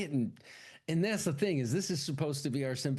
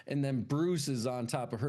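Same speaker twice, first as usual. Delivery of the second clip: usual speaking voice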